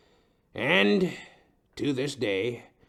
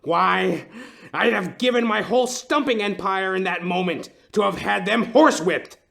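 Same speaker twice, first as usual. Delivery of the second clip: building from loud to a high, louder furious cry; rising fury; 6 semitones higher, 5 dB louder than usual. Acted. Client raging over police prejudice, building fury. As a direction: let the outrage swell to a cry.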